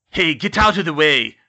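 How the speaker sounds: cranky wheezing yell; wheezing caretaker. A narrator's reading. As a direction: wheeze out an angry order loudly. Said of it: "Filch wheezed behind her".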